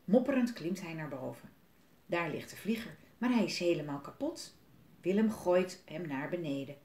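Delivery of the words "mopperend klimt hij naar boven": usual speaking voice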